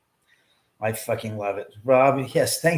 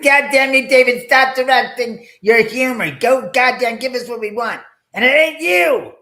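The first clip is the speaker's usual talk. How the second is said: mock furious yell; 10 semitones higher, 11 dB louder than usual. Yelling at David.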